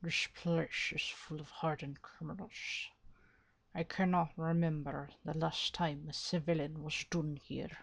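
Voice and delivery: low, hushed character voice; emulated voice. Shown as a demonstration. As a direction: test the voice quietly and low. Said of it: "if I could show, like, emulate".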